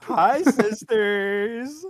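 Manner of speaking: high, loud camp influencer greeting; drawn-out, hammed-up vlogger hello; James Charles. Co-host spoofing a beauty influencer as dictator, loud high camp greeting. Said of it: "James Charles is Hitler?".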